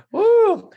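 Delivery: high loud woo; delighted whoop. Real, not acted.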